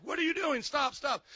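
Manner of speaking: high-pitched retold bewilderment; imitating startled interruption. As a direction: cut in like a baffled listener. Retells intern interrupting him in alarm.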